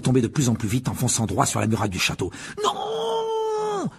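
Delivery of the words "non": long, high-pitched howl of no; drawn-out panicked yell; desperate scream. A narrator's reading. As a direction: scream it out and hold it. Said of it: "hurla Ron en tournant désespérément le volant".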